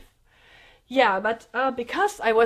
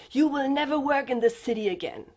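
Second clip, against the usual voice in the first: higher-pitched mock villain threat; comical movie threat; 4 semitones higher than usual. Retelling his threat to ruin her career, comical movie-villain imitation. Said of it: "like in the style what you hear in, in movies, almost comical".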